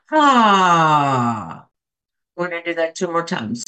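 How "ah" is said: big audible sigh, louder. Shown as a demonstration. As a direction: release it all in one long loud sigh. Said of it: "and then sigh it out".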